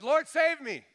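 loud, high shout for rescue; desperate cry. Retold or imitated. Sinking Peter cries unto God, desperate shout. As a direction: cry out from the heart as if drowning. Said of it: "just that heart's cry unto God".

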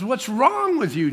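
high, drawn-out incredulous rebuke; acted scolding. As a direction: stretch WRONG as if scolding a foolish servant. Voices the Lord scolding him.

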